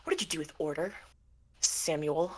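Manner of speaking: low, very soft, menacing question; quiet confrontation. Acted. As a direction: confront them low and hushed. Calamity confronts Sammy face to face.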